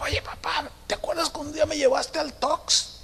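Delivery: put-on high, soft child voice; little girl. Little girl reminds dad of an outing, high soft child voice. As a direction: imitate a small daughter recalling an outing, light and high. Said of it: "dice la niña".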